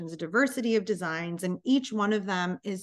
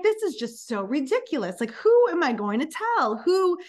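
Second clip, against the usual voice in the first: louder, higher exasperated rant; mock exasperation; 7 semitones higher, 5 dB louder than usual. Telling her mother-in-law, a hammed-up louder rant. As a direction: throw up your hands at the absurdity. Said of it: "And I was like".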